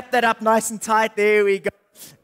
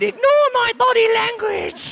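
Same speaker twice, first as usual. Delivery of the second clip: much higher cartoon wolf voice; a wild puppet wolf yelling; 12 semitones higher than usual.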